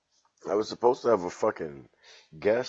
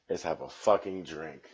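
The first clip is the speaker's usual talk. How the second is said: ordinary talk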